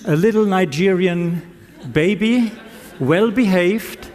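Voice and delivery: usual speaking voice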